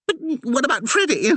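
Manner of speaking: frantic note. A narrator's reading. The curate asking the Reverend about the dog, frantic. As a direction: voice climbing in a frantic worry. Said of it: "his voice taking on a frantic note".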